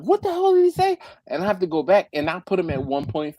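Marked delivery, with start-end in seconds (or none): 0.0-1.0 much higher, incredulous question; exasperated, can't follow him; baffled outburst. Retold or imitated. Lost while watching fast videos, baffled. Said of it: "I'm like".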